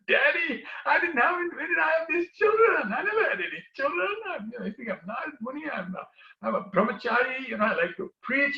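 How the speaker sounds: high, bewildered denial, tumbling out; a monk panicking at being called daddy; flustered protest. Acted. Narad embarrassed by unknown children.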